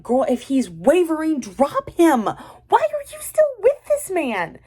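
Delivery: high, drawn-out disbelieving cry; exasperated outburst. Acted. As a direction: climb into a squealed, incredulous scolding.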